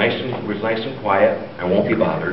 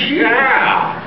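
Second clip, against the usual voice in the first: very high, delighted Irish cry; hammed-up high Irish brogue; drunk Irishman; 13 semitones higher, 4 dB louder than usual. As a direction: confirm it like a tipsy Irishman, pitched way up. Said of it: "the Murphy twins are drunk again".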